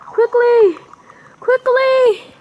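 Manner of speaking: high, loud calls; urgent high-pitched shout; urgent urging. Real, not acted. Urging on in game.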